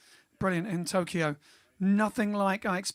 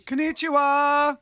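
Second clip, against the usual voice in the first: higher, louder, slow "Konnichiwa"; cheery Japanese greeting; 6 semitones higher, 7 dB louder, slower than usual. Acted. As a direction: greet them big and bright.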